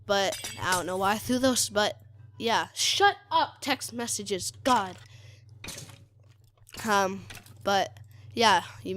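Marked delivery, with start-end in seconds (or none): 2.7-5.0 exasperated outburst. Real, not acted. Player scolds his text messages for interrupting, exasperated. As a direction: snap at an annoying interruption.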